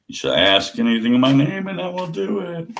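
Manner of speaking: lofty scripture voice. Acted.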